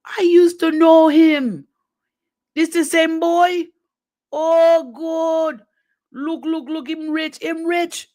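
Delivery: high, slow Jamaican auntie voice; halfway Jamaican accent, gossiping. Retold or imitated. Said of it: "My halfway Jamaican accent".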